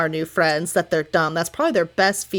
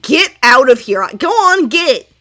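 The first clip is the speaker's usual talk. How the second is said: loud, firm shooing; 4 semitones higher, 9 dB louder, slower than usual. Acted. Sativa loudly shoos the second merfolk away. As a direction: shoo it off like a stray. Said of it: "she's like".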